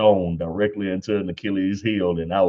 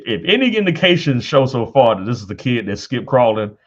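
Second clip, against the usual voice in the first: louder, building into a high laugh; laughing punchline; 5 semitones higher, 7 dB louder than usual. Real, not acted. A dad joking about his son skipping crawling, loud laugh. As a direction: land the joke and crack up.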